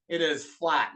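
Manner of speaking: ordinary delivery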